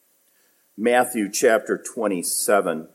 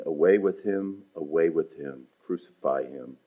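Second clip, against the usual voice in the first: the crowd's cry read softly, dropping lower; quiet reading; 6 semitones lower, 6 dB quieter than usual. Retold or imitated. Preacher rereading the crowd's demand, soft and low. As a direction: read it low and hushed, almost to yourself. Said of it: "And notice verse fifteen".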